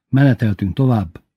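ordinary delivery

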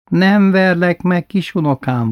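cold granny promising not to hit; shivering plea. Retold or imitated. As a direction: plead while freezing, slowly. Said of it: "vacogta nagymama".

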